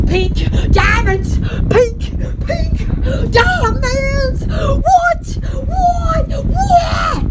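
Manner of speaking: increasingly high, squealing excitement; mock-ecstatic. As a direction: build to gasping high squeals. Gushing over imagined pink diamonds.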